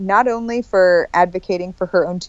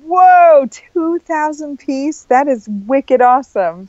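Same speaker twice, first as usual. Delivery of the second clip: amazed; 6 semitones higher, 4 dB louder, slower than usual. Real, not acted. Amazed at Lego rocket.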